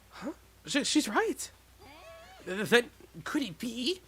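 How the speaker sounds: lightly voiced gasping realisation; startled character voice.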